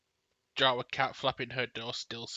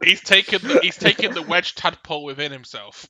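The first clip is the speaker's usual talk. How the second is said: excited; 5 semitones higher, 15 dB louder than usual. Real, not acted. Shouting gleefully over the laughter.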